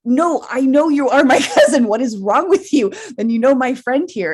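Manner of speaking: loud, laughing as she quotes the cousin; laughing insistence. Retold or imitated.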